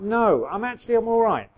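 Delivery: mock defiant yell; shouting denial. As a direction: shout that you're all right.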